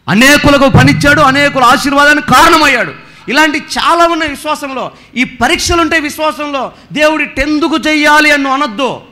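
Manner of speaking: high, loud triumphant preaching; rousing preaching. Acted. Preacher celebrating a blessed believer, high and loud. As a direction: lift the whole church with it.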